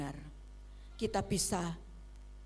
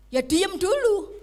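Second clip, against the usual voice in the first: mock reply; 9 semitones higher, 10 dB louder than usual. Voiced reply, slow and loud.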